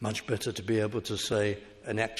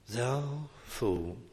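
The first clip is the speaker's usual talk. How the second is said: compassionate pity; 5 dB quieter than usual. Shown as a demonstration. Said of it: "with real compassion and pity".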